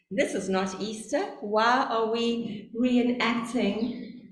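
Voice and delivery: usual speaking voice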